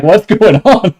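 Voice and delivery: high, loud mock confusion; bewildered quote. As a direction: pitch it up like a baffled character. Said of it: "Loka is like".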